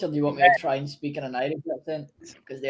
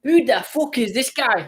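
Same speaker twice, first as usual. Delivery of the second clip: higher, louder Irish accent; 8 semitones higher, 6 dB louder than usual. Shown as a demonstration. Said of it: "oh, I can't do that one".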